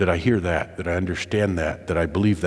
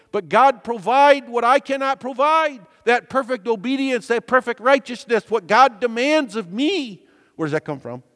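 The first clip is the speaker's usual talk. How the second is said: impassioned preaching, much higher and louder; fervent sermon peak, pitch climbing; preaching at full force; 9 semitones higher, 3 dB louder than usual. Acted. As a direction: build to a soaring pulpit climax. Preaches with rising intensity.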